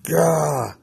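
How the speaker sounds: high, retold "gah" of frustration; frustrated groan. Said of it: "I'm just like".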